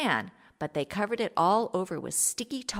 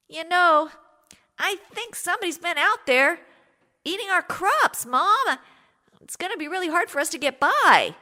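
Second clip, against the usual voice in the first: put-on higher, louder worried boy telling mom; young son's worried voice; 10 semitones higher, 4 dB louder than usual. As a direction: sound like an anxious son warning his mother. Son voiced worrying to mother.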